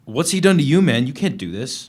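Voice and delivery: high, loud horrified shout; shocked outcry. Acted. Prisoner crying out at the lieutenant over beaten Eddie. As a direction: cry out in shock at the beating.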